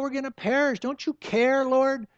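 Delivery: mock panic in the storm; panicked disciples. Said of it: "And they're freaking out".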